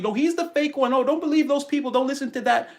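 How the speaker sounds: high, mocking voice of a doubter; mocking naysayer. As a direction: parody the people calling him fake, up high. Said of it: "if they're gonna tell you".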